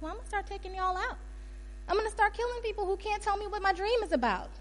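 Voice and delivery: high, mock-threatening rant; high-pitched royal ultimatum; threatening king. Acts out the king's death threat.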